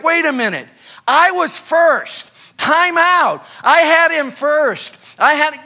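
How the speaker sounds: high, loud, drawn-out whine of being first; mock indignant protest. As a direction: act the spoiled kid demanding his turn. Acting out a whiny complaint.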